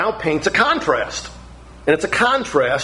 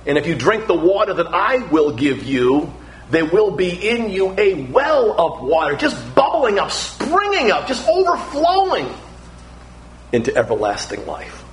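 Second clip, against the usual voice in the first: swelling joyful promise; rising fervour; 3 semitones higher than usual. Acted. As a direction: build it like a spring overflowing. Jesus promise building up.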